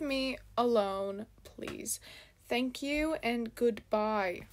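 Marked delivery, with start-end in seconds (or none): none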